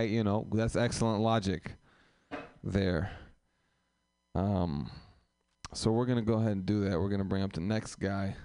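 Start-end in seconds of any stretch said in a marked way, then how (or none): none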